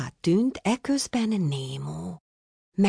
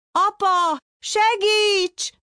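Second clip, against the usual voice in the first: much higher, louder panicked scream; cry for help; 5 dB louder, much slower than usual. A narrator's reading. Caught son screams to his father in panic. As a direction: scream for dad as the net closes. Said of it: "kiáltott Némó".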